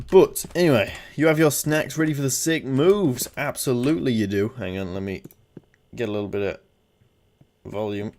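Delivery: ordinary talk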